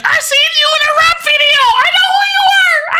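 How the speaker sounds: fast, high, shouted fan voice with a whiny cry; imitating a fan. Fan recognizing a rapper, mock shouting high and fast. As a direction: ham up an overexcited fan yelling at a rapper. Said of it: "And he's like".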